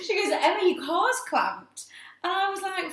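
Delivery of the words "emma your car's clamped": higher, slow, laughing Liverpool warning; Liverpool accent. Retold or imitated. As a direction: break the news slowly in a giggly put-on Liverpool accent. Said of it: "Can't do her accent".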